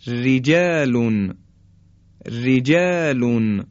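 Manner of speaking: رجالون slowed down, with a thin, light ر; slow light r demo. Shown as a demonstration. Tajwid teacher slowly reciting رجالون for students. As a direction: draw out رجالون, keeping the ر fine.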